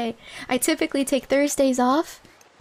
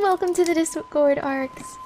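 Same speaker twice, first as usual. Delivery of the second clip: normal talking voice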